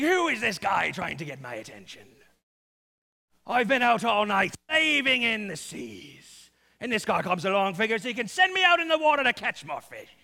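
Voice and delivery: loud, put-on pirate voice; grumbling fisherman. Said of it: "who probably talks a bit like this".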